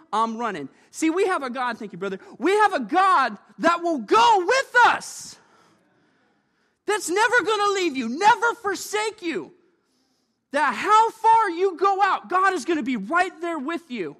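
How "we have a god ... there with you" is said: swelling preacher's cry; full-force preaching. Acted.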